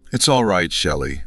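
ordinary delivery, nothing marked

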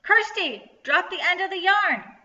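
high, loud shout to a friend; loud call. A narrator's reading. Rachel calling to Kirsty during the chase, loud call. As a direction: call out across a distance. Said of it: "to call in a loud voice".

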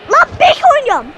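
high, loud complaint at being played at back; angry outburst. Real, not acted. Gamer outraged at being put in defence, loud. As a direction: snap it out, fed up.

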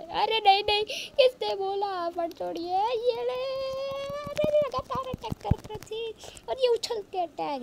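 high, loud alarmed outburst; panicked yelling. Real, not acted. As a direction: yelp in alarm as the spider keeps attacking. Attacked by spider in game.

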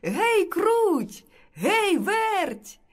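high-pitched rooster's call; sing-song summons. A narrator's reading. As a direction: call the two mice over, drawn out and high. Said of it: "знову кличе мишенят".

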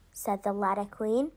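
plain reading voice

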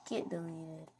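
soft, tossed-off muttered taunt; quiet offhand taunt; soft throwaway. Pretend. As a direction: let it drop quietly, amused. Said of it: "It's so funny saying that".

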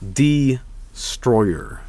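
ordinary talk

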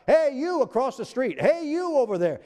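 high-pitched call across the street. Shown as a demonstration. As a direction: hail someone on the far side of the road. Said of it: "It's to call out".

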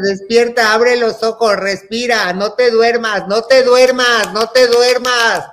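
high, loud, slowing pleas to stay awake; urgent pleading. Retold or imitated. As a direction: insist she stay conscious, slowing down. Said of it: "Y diciéndome".

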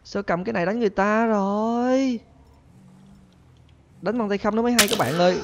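high-pitched, whiny objection; indignant protest. Pretend. Protesting after being hit in game.

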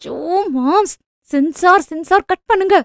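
high-pitched, embarrassed protest; bashful shushing. A narrator's reading. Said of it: "கூச்சத்துடன் கணவனை அடக்கினாள்".

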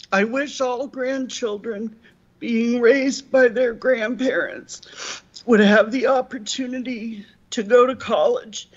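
choking up, breaking into halting phrases; tearful, voice giving out at the end; real crying. Real, not acted. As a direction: struggle through tears, last word fading away. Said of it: "I'm sorry for crying".

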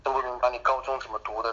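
ordinary talk, nothing marked

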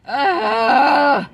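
sudden loud high yelp; loud cry. Real, not acted. Outburst while eating.

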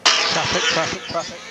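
quick high repeated warning; urgent call. Acted. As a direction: snap out the warning. Engineer urgent warning in pit.

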